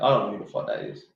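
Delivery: usual speaking voice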